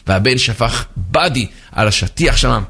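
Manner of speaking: usual speaking voice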